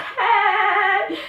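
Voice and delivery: sudden shrill high-pitched "Hey!"; high squeal. Real, not acted. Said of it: "what was that noise?".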